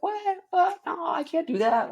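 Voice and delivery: shocked squeal. Retold or imitated. Husband refusing his wife's request, high shocked squeal. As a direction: yelp it out in high-pitched panic. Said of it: "You're like".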